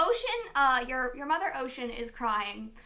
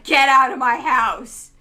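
shout; 17 dB louder, slower than usual. Acted. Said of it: "He's like".

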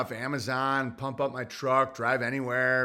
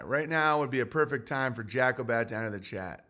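usual speaking voice

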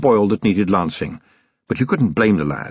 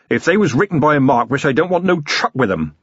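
higher-pitched rough-spoken villager, defiant; truculent blacksmith; 6 semitones higher than usual. Acted. Blacksmith rejects the marquis's rules, truculent. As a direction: play a stubborn working man.

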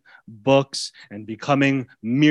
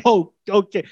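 high, surprised giving in; the beast conceding; meek giving in; 7 semitones higher, 4 dB louder than usual. Retold or imitated. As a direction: give up suddenly, pitched up.

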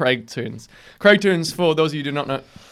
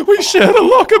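much higher and louder, sing-song; high mock squeal; 9 dB louder than usual. Hammed-up repeat of locker line.

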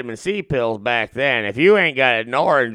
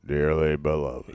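much lower, soft, solemn preacher bass; deep wedding officiant; 11 semitones lower, 8 dB quieter than usual. Pretend. Friend asks for a wedding intro, answered in solemn deep bass. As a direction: open a wedding in your lowest solemn voice. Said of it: "give me the intro to the wedding".

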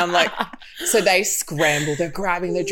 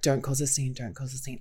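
low, very soft repeated plea; hushed warning; 6 semitones lower, 9 dB quieter than usual. Retold or imitated. Friend warning Riley as they walk in, hushed warning. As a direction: warn her quietly, fading away.

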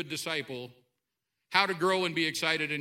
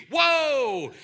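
higher, louder astonished whoa; wide-eyed preacher's whoa; amazed whoa; 8 semitones higher, 7 dB louder than usual. Retold or imitated. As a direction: gasp it out like you can't believe your eyes. John overwhelmed by vision.

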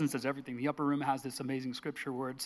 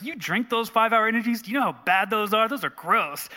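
loud, higher-pitched mock disdain; disdainful; 7 semitones higher, 7 dB louder than usual. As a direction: retell a stranger's appalled scolding, loudly. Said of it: "looks at me with dis-, disdain".

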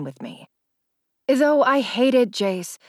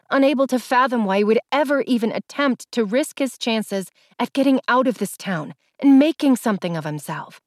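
ordinary delivery

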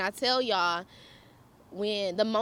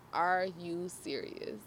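slow, low, quiet disbelief; deadpan mock exasperation; fed-up disbelief; 5 semitones lower, 7 dB quieter, slower than usual. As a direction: imitate an unimpressed boyfriend, drawn out and soft. Boyfriend's fed-up look, acted out.

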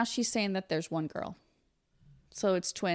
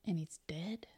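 whispering; 3 semitones lower, 10 dB quieter than usual. Real, not acted. Hushed question about death.

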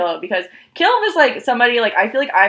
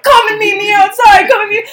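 loud, much higher retold yell; shout; 10 semitones higher, 7 dB louder than usual. Yelling at the girl from outside the venue. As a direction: call her out to fight outside.

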